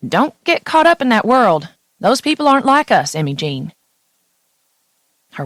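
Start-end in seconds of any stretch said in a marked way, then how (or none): 0.1-3.7 Southern Tennessee mother warning her daughter, pitched higher; a lightly voiced Tennessee mama, earnest and higher; Tennessee mom accent. Mother on the phone cautioning her daughter, in a light Tennessee accent. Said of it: "My mom's accent burst through the phone".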